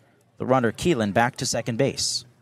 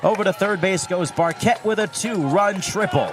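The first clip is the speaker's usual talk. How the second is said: excited play-by-play; 5 semitones higher than usual. Real, not acted. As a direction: call the big hit with rising energy. Home run call excitement.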